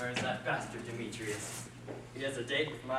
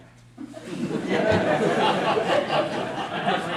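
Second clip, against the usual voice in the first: big giddy laugh, louder and higher; crazed loud laugh; 7 semitones higher, 14 dB louder than usual. Acted. Enchanted Lysander laughing at his new love for Helena. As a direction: laugh like a lovestruck madman.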